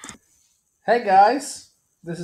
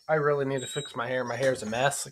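ordinary talk

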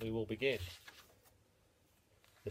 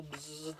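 soft buzzing hum; fly buzz; 9 dB quieter than usual. Acted. The flies buzzing at the mole, a soft hum.

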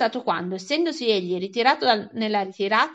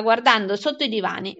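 usual speaking voice

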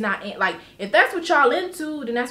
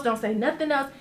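ordinary talk

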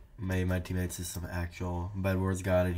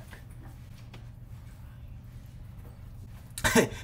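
breathless high-pitched giggle; 11 semitones higher than usual. Real, not acted. Laughing at the raging kid, breathless high giggle. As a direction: giggle up high, almost silent.

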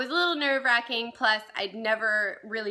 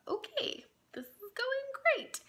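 tiny, high-pitched fake optimism; sarcastic unease; 7 dB quieter, slower than usual. Inwardly reacting when her date orders a drink, sarcastic unease. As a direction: squeak it softly and slowly under your breath.

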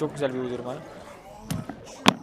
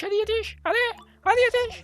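urgent high cry. Real, not acted. Urging teammate to hurry.

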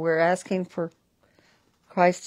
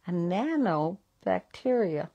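usual speaking voice

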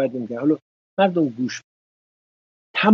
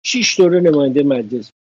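usual speaking voice

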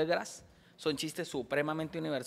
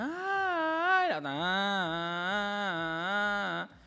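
high, a bit louder crying imitation of alarms; mock crying wail; 6 semitones higher, 5 dB louder, much slower than usual. Showing a joke with alarms crying at a wake, mock wail. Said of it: "y llorando".

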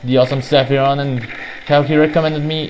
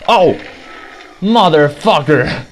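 startled angry yell; 3 semitones higher, slower than usual. Real, not acted. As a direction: bellow it out in shock. Sudden hit in game.

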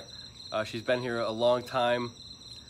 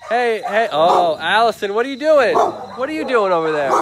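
excited greeting; 7 semitones higher, 11 dB louder than usual. Real, not acted. Greeting viewer Allison on the stream.

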